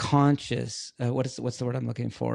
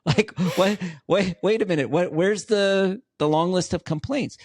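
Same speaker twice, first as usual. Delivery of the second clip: mock disbelief; 6 semitones higher, 4 dB louder than usual.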